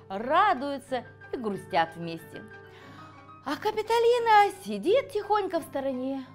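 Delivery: ordinary delivery, nothing marked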